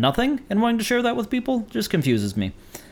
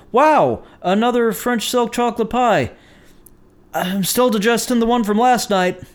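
higher-pitched, mildly overwhelmed reaction; acted polite surprise; 6 semitones higher than usual. Receiving a second pie at work, politely taken aback. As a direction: feign being pleased by an unwanted repeat gift.

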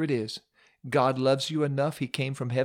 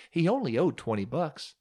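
ordinary talk, nothing marked

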